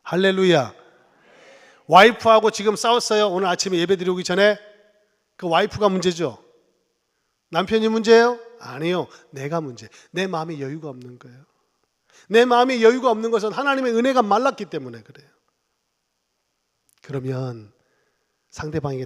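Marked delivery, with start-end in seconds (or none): 0.0-0.7 louder hallelujah. Real, not acted. Pastor demanding a bigger amen, shouted hallelujah. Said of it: "아멘 크게 하세요". 1.9-15.3 loud, high sermon delivery; full-force preaching. Real, not acted. Pastor preaching to the congregation, loud and driving.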